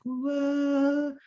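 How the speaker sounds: a long, high, drawn-out whoa of wonder; amazed whoa. Retold or imitated. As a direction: gasp in mock awe. Guides amazed by human feelings.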